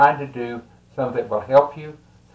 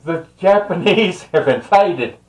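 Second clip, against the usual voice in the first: higher, half-laughing mock scream of alarm; laughing through a mock alarmed cry; retold panicked scream; 7 semitones higher, 4 dB louder than usual. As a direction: playfully imitate mom crying out in panic. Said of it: "I could hear her screaming".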